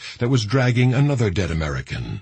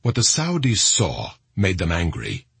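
usual speaking voice

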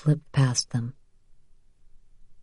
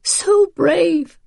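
high, cracking grief; broken voice; 13 semitones higher than usual. A narrator's reading. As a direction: choke the words out. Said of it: "Rachel said brokenly".

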